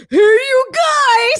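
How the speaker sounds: high, drawn-out goofy holler; stretched-out, high-pitched comic call; Sloth. Retold or imitated. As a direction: imitate a movie character's long hollered greeting. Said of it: "it's Sloth from the Goonies".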